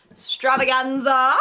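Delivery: loud, slow showman drawl; announcer flourish. Pretend. Showy announcer word.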